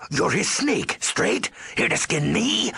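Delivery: very high, agitated accusation; frenzied ghoul accusing a spy; paranoid outburst. Acted. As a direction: explode with suspicion, pitch shooting up. Boss suspects the visitor is an assassin.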